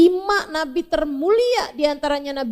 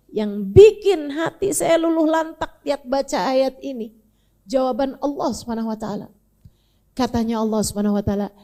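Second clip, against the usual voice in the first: ordinary delivery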